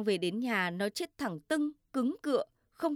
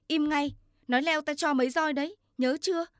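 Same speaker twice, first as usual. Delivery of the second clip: higher, louder, fast angry scolding; mother yelling; 6 semitones higher, 4 dB louder, faster than usual. A narrator's reading. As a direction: shout the child down, threatening the cane. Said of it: "Mẹ quát tôi". (mẹ quát con, to, cao và nhanh)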